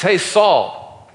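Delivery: acted hail from afar; calling out. David hailing Saul from the hilltop, calling out. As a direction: raise the voice a little and pitch it up to carry.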